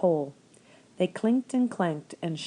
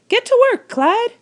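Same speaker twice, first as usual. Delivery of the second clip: put-on much higher, louder old woman calling out; 12 dB louder than usual. A narrator's reading. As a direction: holler it at the rabbit like a farm wife. Old woman ordering rabbit.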